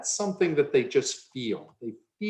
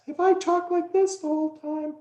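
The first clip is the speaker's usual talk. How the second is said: much higher, odd put-on voice all through; weird high voice. Shown as a demonstration. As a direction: squeak the whole line out way up high. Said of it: "you would probably think that's a weird voice".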